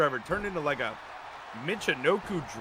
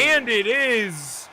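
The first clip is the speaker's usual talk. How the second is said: wrestling commentator; 5 semitones higher, 5 dB louder, slower than usual. Acted.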